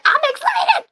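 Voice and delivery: very high, loud squeal of excitement; excited. Retold or imitated. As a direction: burst with giddy joy. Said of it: "I'm like".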